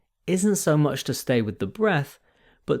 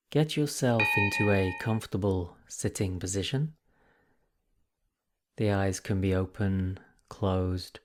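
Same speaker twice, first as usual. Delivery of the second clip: guided meditation; 2 semitones lower than usual. Acted. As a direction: ease the listeners gently into the practice, leaving space between phrases.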